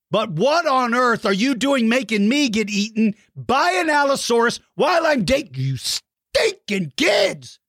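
high-pitched comic outrage, building to a yell; mock tirade. Host to kids who drew him eaten, mock outraged. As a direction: build playful indignation into a squawk.

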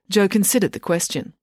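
usual speaking voice

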